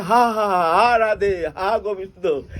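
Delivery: loud, high, drawn-out mock wail; devotional wailing. Devotees weep in love of God, imitated loud wailing. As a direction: imitate a congregation crying out to God, slow and loud. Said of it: "सब कोई रो रहे हैं".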